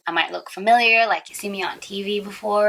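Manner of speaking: ordinary talk, nothing marked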